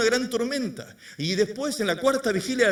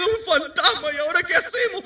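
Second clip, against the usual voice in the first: high, loud hammed-up fright; mock panicked cry; 10 semitones higher, 4 dB louder than usual. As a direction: play the panicking disciples for laughs. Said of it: "dando voces de miedo".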